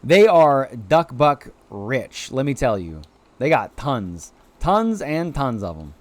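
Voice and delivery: ordinary delivery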